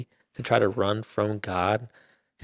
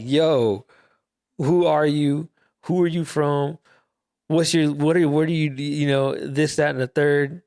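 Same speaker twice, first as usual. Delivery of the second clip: panicked sailors; 5 semitones higher than usual. Retold or imitated. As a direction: fire the questions at him in a rush of panic. Panicked sailors question Jonah.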